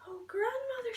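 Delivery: put-on a frightened little girl catching her breath; gasped. Girl startled by Granny's strange looks, high gasp. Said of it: "she gasped".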